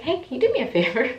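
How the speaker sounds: high, quick and loud; acted request. Acting out asking the nurse.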